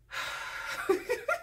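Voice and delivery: high, helpless laughing fit. Real, not acted. Cracks up about the donuts.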